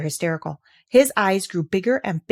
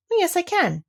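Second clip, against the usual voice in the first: put-on boy answering; 11 semitones higher than usual. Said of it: "he answered".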